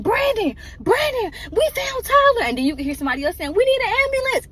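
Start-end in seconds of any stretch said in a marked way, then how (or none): 0.0-2.5 high, loud frantic yelling of the name; phone scream. Retold or imitated. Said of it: "He in the phone screaming". 3.5-4.5 high, loud panicked cry; screaming for help. Retold or imitated. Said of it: "Everybody screaming".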